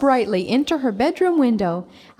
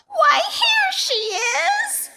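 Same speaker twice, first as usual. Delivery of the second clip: very high, slow shout of discovery; Baby Bear crying out; slower than usual. A narrator's reading. Baby Bear finds Goldilocks, high excited shout. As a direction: shriek it like a startled cub.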